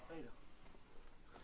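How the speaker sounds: slow, breathless whisper; hushed reluctant whisper; whispered giving in. Acted. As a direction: give in under the breath. Son backs down, hushed.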